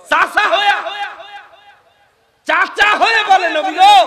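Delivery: high, loud sermon call; full-force preaching. Real, not acted. Preacher tells how the uncle addressed the Prophet, full-force preaching. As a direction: cry it out across the gathering.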